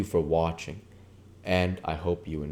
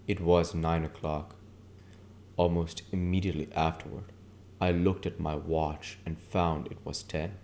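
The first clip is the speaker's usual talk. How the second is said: ordinary delivery, nothing marked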